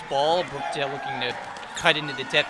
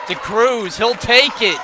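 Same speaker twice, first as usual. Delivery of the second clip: much higher and louder, rising to the shot; excited call; 8 semitones higher, 8 dB louder than usual. Real, not acted. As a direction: build the call as he shoots. Live call, buzzer approaching.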